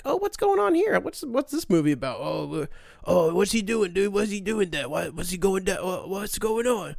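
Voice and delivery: hammed-up nosy movie interrupter; clueless questioner. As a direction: pester with dopey, repeated questions. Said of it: "they start asking me questions".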